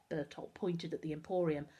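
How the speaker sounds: usual speaking voice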